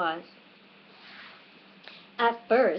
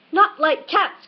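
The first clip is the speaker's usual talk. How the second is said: high, loud indignant outburst; shrill passionate cry; 9 semitones higher, 7 dB louder, slower than usual. A narrator's reading. Offended mouse retorting to Alice, shrill and passionate. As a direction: fling it back, outraged. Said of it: "in a shrill, passionate voice".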